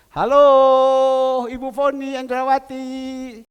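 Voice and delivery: bright, loud phone greeting; loud hello. Acted. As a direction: panggil keras lewat telepon. Greets her loudly on the phone. (menyapa keras lewat telepon)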